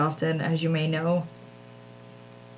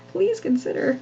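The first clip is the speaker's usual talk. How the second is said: high, sing-song flight attendant; like Siri or a flight attendant; 10 semitones higher than usual. Shown as a demonstration. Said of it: "like a flight attendant or something".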